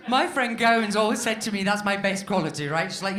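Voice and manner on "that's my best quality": usual speaking voice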